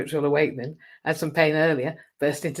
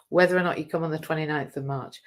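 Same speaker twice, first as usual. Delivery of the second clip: ordinary delivery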